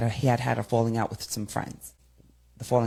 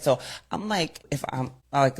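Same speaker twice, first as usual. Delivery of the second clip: usual speaking voice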